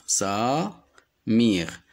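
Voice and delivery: "Samir" drawn out as sa, pause, mir; syllable by syllable. Shown as a demonstration. Teacher isolating the syllable with I, syllable by syllable. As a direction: split "Samir" slowly in two. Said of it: "nous donne le son I".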